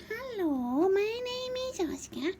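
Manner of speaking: high, cutesy cat voice; cat Oscar saying hello, high and sweet; voicing the cat. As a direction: speak as the cat greeting kids. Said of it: "Saying hello to everybody".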